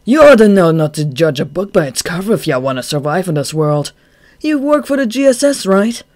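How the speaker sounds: loud, smug warning; cocky lecture. Acted. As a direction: lecture the gunman smugly. Chuya lecturing the gunman.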